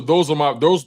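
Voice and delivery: ordinary talk, nothing marked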